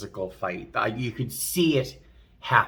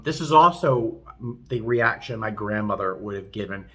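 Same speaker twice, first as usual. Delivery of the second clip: ordinary delivery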